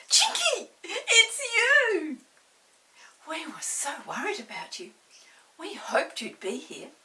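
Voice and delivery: high, loud joyful cry; excited shout. A narrator's reading. Molly greeting the returned pixie, delighted shout. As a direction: shout with delight at seeing him. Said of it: "Molly gave a shout".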